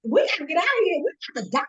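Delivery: high-pitched, frantic mock plea; retold panic. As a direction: re-enact your own panic, pitch shooting up. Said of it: "when I panic".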